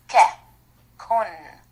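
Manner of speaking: normal talking voice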